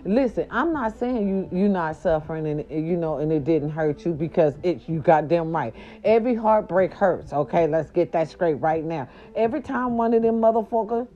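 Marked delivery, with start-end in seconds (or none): none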